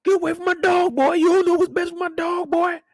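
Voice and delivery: high, strained voice of the angry owner; angry dog owner. Retold or imitated. Owner yelling at Lamar to leave his dog. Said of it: "this guy came out here".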